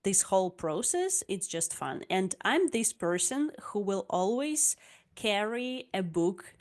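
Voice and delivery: normal talking voice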